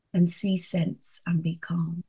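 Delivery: softly whispered reassurance; whispered calm. Acted. Preacher soothing listeners, a whispered be calm. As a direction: hush the words to calm them.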